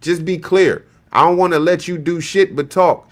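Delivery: usual speaking voice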